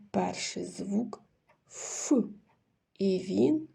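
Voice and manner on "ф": "ф" alone as a hard consonant; hard consonant sound. Shown as a demonstration. Teacher gives children the first sound, hard "ф". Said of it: "твердий приголосний".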